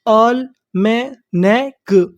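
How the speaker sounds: almanac very slowly, in separate syllables with the final k apart; slow teaching breakdown of almanac. Shown as a demonstration. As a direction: break almanac into isolated sounds. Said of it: "तो meaning क्या बनी हमारी".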